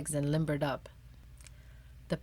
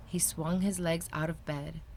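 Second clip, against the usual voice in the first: ordinary delivery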